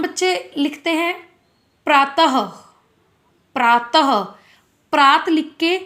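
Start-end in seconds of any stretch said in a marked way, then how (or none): 1.8-2.4 प्रातः ending in an audible "ha"; visarga like ह. Shown as a demonstration. Teacher giving pupils an example, प्रातः with a voiced-out final ह. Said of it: "ह की तरह होता है". 3.5-4.2 प्रातः as praa-ta-ha; visarga like ह. Shown as a demonstration. Teacher repeating the example for pupils, प्रातः with final ह sounded. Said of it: "ह की तरह होता है".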